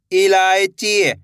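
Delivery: इलायची drawn out slowly as one word; slow blended word. Shown as a demonstration. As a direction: stretch इलायची slowly, joining the syllables. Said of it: "पहले इलाय पढ़ो". (धीरे-धीरे जोड़कर इलायची)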